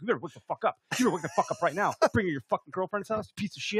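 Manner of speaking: retold angry threat, not shouted; mock-furious ordering; losing it. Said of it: "I was, like, losing my".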